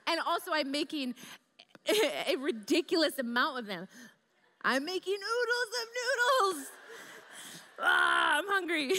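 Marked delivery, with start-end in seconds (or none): 4.6-8.9 high, crying-out hungry yell; whiny shout. Retold or imitated. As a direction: wail it like a starving teen. Teen self whining hungry.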